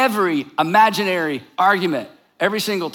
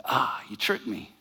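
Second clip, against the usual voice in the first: low, soft mock defeat; deflated; 8 semitones lower, 9 dB quieter than usual. Arguer caught out by James's logic, deflated. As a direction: sigh it out low and quiet, caught out.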